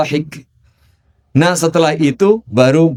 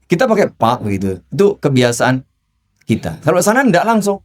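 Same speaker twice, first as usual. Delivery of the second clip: normal talking voice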